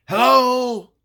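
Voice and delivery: calling out loud into the house. Retold or imitated. Bobby calls loudly into the empty house. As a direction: call out into the dark doorway. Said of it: "in a loud voice".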